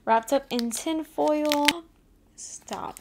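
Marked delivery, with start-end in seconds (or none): 2.4-3.0 whispered, much softer disbelief; stunned whisper. Real, not acted. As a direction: breathe it out, stunned. Sees the ring she wanted.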